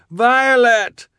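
high shout over rushing water. A narrator's reading. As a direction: yell over the roar of water. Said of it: "Quigley shouted over the roar".

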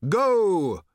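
loud, high, drawn-out cry; shouted. A narrator's reading. Old man launching the riders, loud shout. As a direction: bellow it as a send-off. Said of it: "shouted Thrashbarg".

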